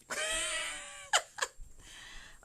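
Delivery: long, squealing high-pitched laugh; drawn-out high cackle. Real, not acted. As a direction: laugh way up high at your own joke. Laughs at own accent joke.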